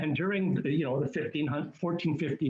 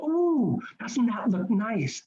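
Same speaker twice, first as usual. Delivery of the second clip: high squeal of delight, ooh; a shopper gushing over a carpet; mock gushing shopper; 6 semitones higher, slower than usual. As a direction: coo over it like a tempted tourist. Mock shopper admiring goods.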